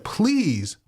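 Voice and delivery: high-pitched mock begging at the screen; exasperated pleading. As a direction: plead like a fed-up viewer. Pleading aloud with the film's fighter.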